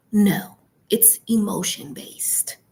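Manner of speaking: ordinary delivery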